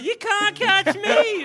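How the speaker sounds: high, slow taunt from a smug possum. Pretend. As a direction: sing it out like a cocky little possum. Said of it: "the possum's gonna chip in like".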